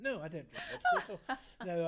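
high, loud laughing protest; bursting into laughter mid-sentence; laughing denial. Real, not acted. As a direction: laugh it off, shrugging away your own joke. Joking denial after vodka quip.

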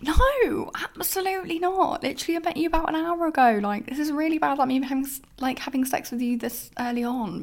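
high, fast, indignant protest; outraged refusal. Retold or imitated. Refusing the guy she just met, high fast outrage. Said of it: "And I'm like".

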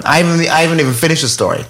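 usual speaking voice

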